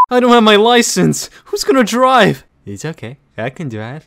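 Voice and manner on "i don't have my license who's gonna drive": loud, higher panic; panicked yelling. Acted. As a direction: yell in a comic panic. Panicked shouting.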